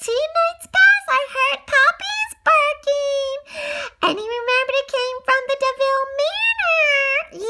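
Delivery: high, slow squeaky cat voice; a little cat reporting to his commander. Acted. Cat reporting to his sergeant, high and slow. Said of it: "Tibbs responded".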